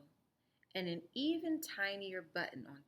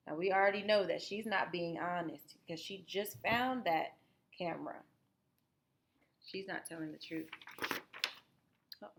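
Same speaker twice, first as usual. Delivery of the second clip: ordinary delivery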